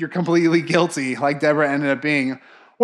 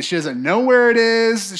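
ordinary delivery

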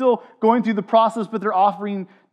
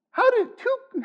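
nasal whine; 3 dB quieter than usual. Retold or imitated. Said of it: "I could see it in your faces".

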